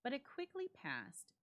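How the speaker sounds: ordinary delivery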